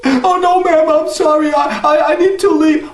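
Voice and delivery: put-on high, stammering scared boy; frightened boy. Jack stammers a frightened apology to the old woman, high.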